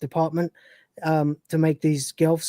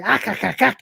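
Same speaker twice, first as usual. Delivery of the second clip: lightly voiced Gelf clicking noises; Gelf gibberish; 4 semitones higher than usual. Crew faking Gelf language to the simulant, light creature voice. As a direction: chatter in made-up creature sounds. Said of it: "random Gelf".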